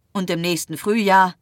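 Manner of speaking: mocking. A narrator's reading. Felix challenges the doctor's plans, mockingly. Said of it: "fragte Felix spöttisch".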